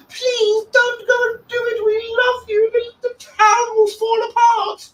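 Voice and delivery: very high squeaky mocking voice; mock pleading fan. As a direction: whine it like a desperate fanboy. Mocking fans begging DT stay.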